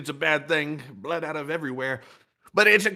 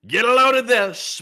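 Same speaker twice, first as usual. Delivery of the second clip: loud announcer; 6 semitones higher, 7 dB louder than usual. Acted. Hyped announcer bit.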